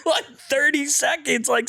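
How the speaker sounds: ordinary talk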